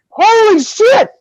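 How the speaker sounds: high, loud, drawn-out exclamation; big slow cry of mock astonishment; retold shock. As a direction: act out stunned horror for comic effect. Retold shocked reaction to goat killing.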